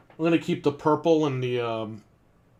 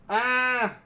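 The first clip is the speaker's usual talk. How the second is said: startled yelp; 9 semitones higher than usual. Real, not acted. Startled by sudden echo.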